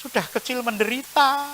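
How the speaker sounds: soft, whiny lament about a hard life; a quiet, sorry-for-yourself grumble; self-pitying complaint. Retold or imitated. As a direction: moan quietly about your bad luck. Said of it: "Jangan mengatakan".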